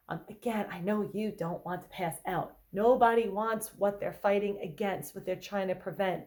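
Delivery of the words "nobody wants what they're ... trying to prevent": normal talking voice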